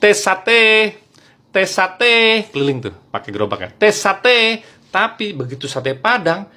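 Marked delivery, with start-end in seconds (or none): 0.0-2.5 high, loud street hawker's cry; standard satay-seller call. Retold or imitated. Madurese satay vendor pushing a cart, high loud call. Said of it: "Standar, ngomongnya gini". 3.7-4.7 high, loud street hawker's cry; standard satay-seller call. Retold or imitated. Madurese satay vendor pushing a cart, high loud call. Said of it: "ngomongnya gini".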